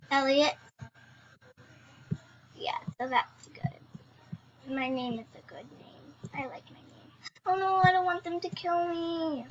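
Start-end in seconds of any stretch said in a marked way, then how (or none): 2.6-7.1 soft unvoiced whisper. Real, not acted. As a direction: breathe it, barely voiced. Whispered aside.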